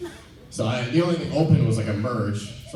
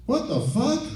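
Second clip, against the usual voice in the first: high, shocked yelp; mock outrage; 12 semitones higher than usual. Startled homeless man looking at his feet, mock shocked. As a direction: squeak it out in disbelief.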